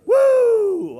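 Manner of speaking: high, very loud "woo"; loud whoop. Real, not acted. Rousing the congregation to stand, with a loud high whoop. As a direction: let out a piercing cheer.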